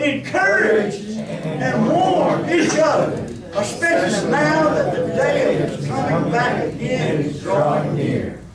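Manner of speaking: high, loud, slow exhortation; fervent sermon climax; preaching at full force. Acted. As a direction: warn them with fire.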